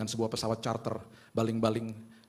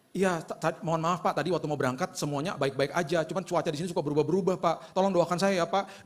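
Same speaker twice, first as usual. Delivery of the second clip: nervous pleading; 6 semitones higher, 4 dB louder than usual. Retold or imitated.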